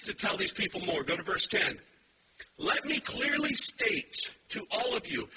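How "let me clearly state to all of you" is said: slow, higher, every word weighted; emphatic. Retold or imitated. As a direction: state it slowly and emphatically. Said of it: "the Greek here is very emphatic".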